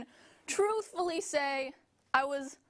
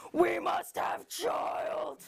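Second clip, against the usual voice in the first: slow, high shouted command; demonic demanding uterus; 4 semitones higher, much slower than usual. Acted. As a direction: boom it like an ancient demon.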